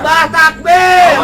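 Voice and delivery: high, slow, loud shout; shouted takbir. Real, not acted. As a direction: drag the crowd into answering. Urging the crowd to shout.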